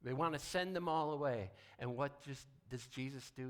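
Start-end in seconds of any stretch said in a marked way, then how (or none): 0.0-1.5 louder, higher, building preaching force; rising preacher's call. Real, not acted. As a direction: preach it out loud to the congregation. Preacher raising voice on fear.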